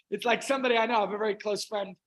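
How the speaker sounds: usual speaking voice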